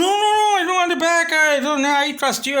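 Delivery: very high, loud mock refusal; protesting seller. Seller refusing the returned chicken, protesting. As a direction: imitate the seller hastily refusing, voice shooting up. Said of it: "So he's like".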